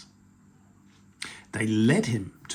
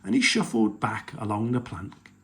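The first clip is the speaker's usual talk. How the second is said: plain reading voice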